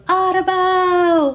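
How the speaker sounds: high, loud, slow drawn-out "Audubon"; kid yelling Audubon. Retold or imitated. Boy at summer camp yelling his family name, slow loud holler. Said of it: "the one boy goes".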